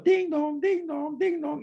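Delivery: high sing-song doorbell chime; doorbell ding dong. Retold or imitated. Visitor keeps ringing at the door, high chime.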